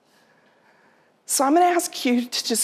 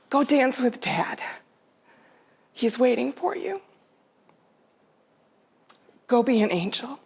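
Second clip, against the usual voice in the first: tender farewell; 5 semitones higher than usual. Retold or imitated. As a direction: ease her gently toward letting go. Farewell to dying mother.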